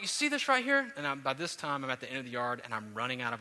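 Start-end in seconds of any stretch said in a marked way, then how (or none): 0.0-0.9 put-on high, bright playful dad voice to a toddler; higher and louder, excited tour guide; dad showing his daughter the monkeys, playfully excited; playful zoo guide. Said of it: "and I'm like".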